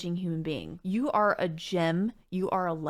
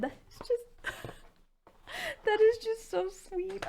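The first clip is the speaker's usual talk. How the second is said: high, soft-edged voice near tears; tearfully touched; 13 semitones higher, 5 dB quieter than usual. Real, not acted. Moved by a very personal gift, tearfully touched. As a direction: choke up with gratitude, pitched high.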